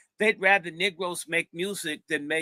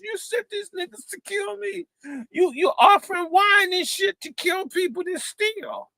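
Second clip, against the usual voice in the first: much higher, aggrieved accusation; accusing thief; 12 semitones higher than usual. Retold or imitated. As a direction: accuse the preacher, high and wounded. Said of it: "He says".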